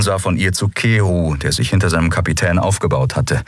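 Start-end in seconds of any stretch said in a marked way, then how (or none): none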